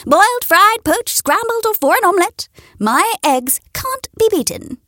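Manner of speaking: high bragging hen voice; high proud hen boast. A narrator's reading. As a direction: list it off proudly. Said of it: "she would boast".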